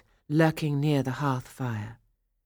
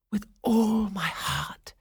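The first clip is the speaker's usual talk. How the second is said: tender; 5 semitones higher, slower than usual. Acted. Cinderella accepts the proposal.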